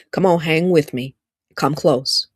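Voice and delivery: ordinary talk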